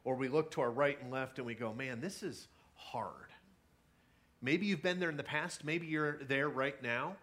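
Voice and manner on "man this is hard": softer, slow and worn down; weary sigh. Retold or imitated. Voicing someone struggling through hard life, soft weary sigh. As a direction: let the words sag with tiredness.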